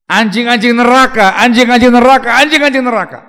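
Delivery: loud, high, ringing repetition; shouted denunciation. Acted. Preacher thundering the translation at the congregation, loud denunciation. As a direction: hammer the phrase home to the crowd.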